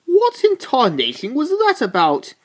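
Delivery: a startled what-on-earth cry; incredulous outburst. Retold or imitated. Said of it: "We're like".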